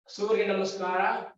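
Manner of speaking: ordinary talk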